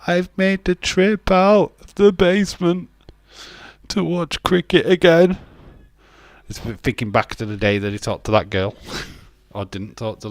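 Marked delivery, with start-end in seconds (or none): 0.1-5.4 higher, louder mocking voice; mock fan voice. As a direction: send up the basement-dweller fan. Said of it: "It's like".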